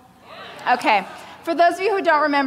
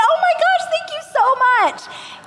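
gushing; 3 dB louder than usual. Retold or imitated. Clueless author thanking publishers for calling her book sweet, very high and gushing. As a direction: squeal with naive delight.

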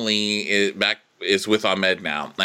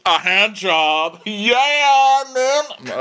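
high, loud, drawn-out whoop; over-the-top imitated cheer; mock raunchy excitement; 13 semitones higher, 6 dB louder, much slower than usual. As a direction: whoop it like a hammed-up party girl. Mimicking her eager, raucous reply.

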